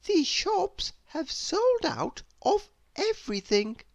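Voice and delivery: high princess voice, innocently explaining; bright and sure of herself. Acted.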